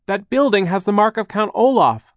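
high, loud urgent warning; shouting. A narrator's reading. Klaus warns Charles over machinery, shouting. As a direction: yell it over the noise. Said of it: "Klaus shouted".

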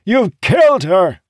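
high, loud accusation; anguished shout. A narrator's reading. Captain accusing those who doomed his ship, shouting. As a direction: shout it in grief and fury. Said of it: "he shouted".

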